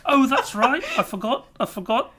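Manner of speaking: high-pitched put-on character voice; Denny. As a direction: play a character who forgot himself, voice pitched high. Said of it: "I'm Denny".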